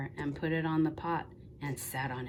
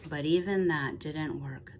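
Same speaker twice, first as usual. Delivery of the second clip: ordinary delivery, nothing marked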